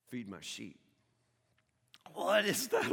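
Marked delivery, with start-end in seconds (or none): 0.1-0.8 soft, low, gentle; tender command. Acted. Jesus to Simon by the fire, soft and tender. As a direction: say it softly like a final plea.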